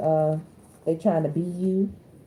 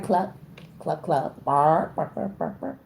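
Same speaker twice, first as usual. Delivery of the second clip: playful imitation of chicken clucks then dog barks, fading to a whisper; silly mock animal noises, barks trailing off whispered; mock clucking and barking; 2 semitones lower, slower than usual. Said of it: "They start speaking chicken".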